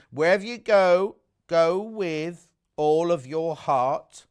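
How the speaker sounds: slow, tender reading of a quote; soothing verse reading. Shown as a demonstration. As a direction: read it like a greeting card. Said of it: "Love hearing you read those verses out, very soothing".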